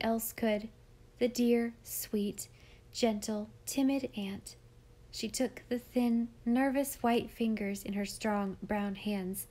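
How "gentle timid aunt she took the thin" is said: plain reading voice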